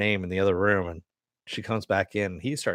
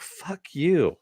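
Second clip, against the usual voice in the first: retold defiance; 7 semitones higher than usual. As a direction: throw back her comeback, pitched up. Retold defiant comeback to an insult.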